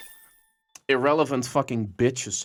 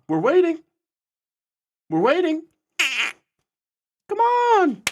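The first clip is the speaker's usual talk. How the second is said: calling out. Acted. Streamer luring a troll like a kitty, high call.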